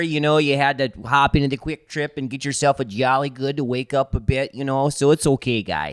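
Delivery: put-on folksy Upper Midwest accent, easygoing; reassuring local guy in a Wisconsin accent. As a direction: lay on a friendly Wisconsin accent. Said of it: "that's not a bad accent".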